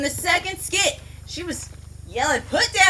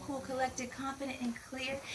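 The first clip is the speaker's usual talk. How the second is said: fading whisper; 13 dB quieter than usual. Real, not acted. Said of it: "catchphrase fades to whisper".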